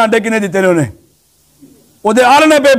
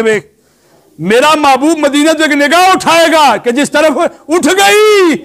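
higher-pitched sermon building to a climax; impassioned preaching; 5 semitones higher than usual. Real, not acted. Preacher urges youths to seek parents' forgiveness, impassioned preaching.

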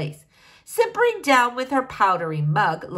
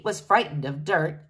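plain reading voice

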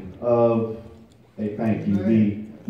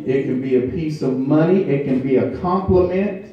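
high, loud, rhythmic preaching with held notes; full-force preaching; 6 semitones higher, 6 dB louder than usual. Acted. Pastor urging the congregation to say thank you, preaching at full force. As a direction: build it up and drive it home to the church.

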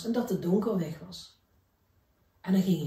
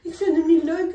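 much higher, louder frightened voice; a cub facing a monster; scared little bear; 9 semitones higher, 8 dB louder than usual. Acted. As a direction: cry out high in fright. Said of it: "zegt Toby".

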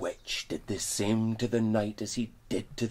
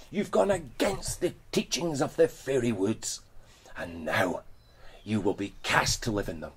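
angry witch; 5 semitones higher, 11 dB louder than usual. Acted. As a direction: spit the curse at the girl. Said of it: "The witch sneered".

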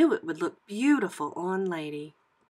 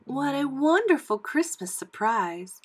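higher, happily surprised voice; delighted Lady; 6 semitones higher, 3 dB louder than usual. A narrator's reading. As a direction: exclaim with high-pitched delight. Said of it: "Lady said".

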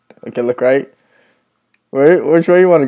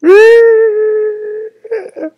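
scared shriek; 5 dB louder than usual. Real, not acted. Friend hears the monster.

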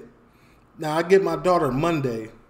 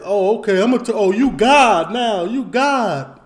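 mocking neighbor; 4 semitones higher, 7 dB louder than usual. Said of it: "He said".

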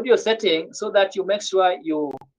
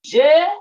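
"j'ai" called out high and loud; teacher booming "j'ai"; loud model word; 6 semitones higher, 7 dB louder than usual. Shown as a demonstration. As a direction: project "j'ai" to the whole class. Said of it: "So we simply say".

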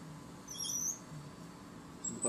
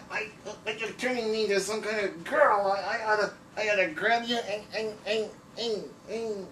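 higher, squawky outraged doodle; indignant cartoon drawing; 7 semitones higher than usual. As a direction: protest as the drawing gets changed. Drawing voice reacts, cartoonist redraws.

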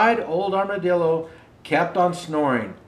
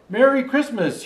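calling out; 8 semitones higher than usual. Acted. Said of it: "he called".

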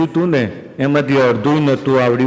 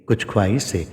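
wistful recitation; 7 semitones lower, 6 dB quieter than usual. Acted.

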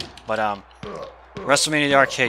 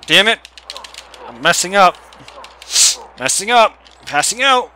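frustrated outburst; 7 semitones higher, 7 dB louder than usual. Real, not acted. Frustrated while being choked.

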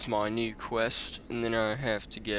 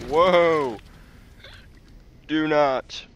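startled shout; 5 semitones higher, 8 dB louder, much slower than usual. Retold or imitated. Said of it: "and I was like".

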